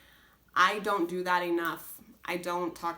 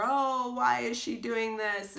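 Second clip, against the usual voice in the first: pitched-up self-pity whine; whining; 5 semitones higher, slower than usual. Retold or imitated. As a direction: whine it to yourself like a sulky kid. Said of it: "complaining and whining to myself".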